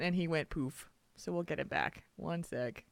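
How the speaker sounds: usual speaking voice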